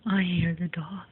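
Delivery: whispered aside; breathy whispered aside. Real, not acted. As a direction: hush it so the dog won't wake. Aside while the dog snores.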